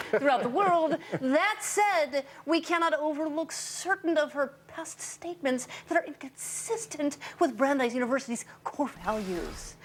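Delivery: mock-solemn read with a laugh; sarcastic reading. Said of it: "Sorry, I was choking on my tears and vomit".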